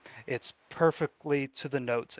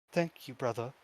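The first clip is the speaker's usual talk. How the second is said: quiet thanks; 6 dB quieter than usual. Acted. Crying girl answers her brother.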